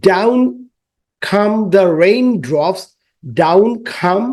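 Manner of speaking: very slow, rising recitation; slow rhyme reading. Shown as a demonstration. Teacher reciting the raindrop line to pupils. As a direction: stretch the words out for the class.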